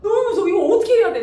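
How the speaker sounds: high, loud frightened plea; mock panic. Wife panicking to her husband about the knife, high and loud. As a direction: beg for help in a panic.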